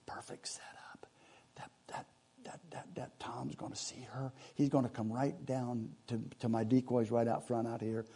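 hushed, breathless whisper; hunting whisper. Retold or imitated. Guide whispering to the hunter in the blind, hushed whisper. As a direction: whisper so the turkey won't hear.

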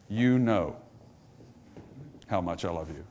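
sinking to a soft, low confession; humble, without boasting. Retold or imitated. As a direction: appeal humbly, trailing into near-silence. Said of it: "Instead of boasting, notice what he says".

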